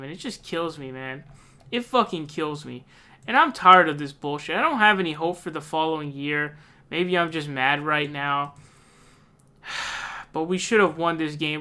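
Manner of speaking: normal talking voice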